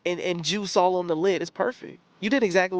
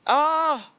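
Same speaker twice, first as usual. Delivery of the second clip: excited shriek; 6 semitones higher than usual. Real, not acted. Overwhelmed by the movie.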